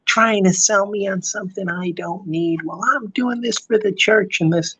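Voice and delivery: high, indignant church lady; lightly voiced self-righteous customer; mock Karen. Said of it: "she starts, like, going".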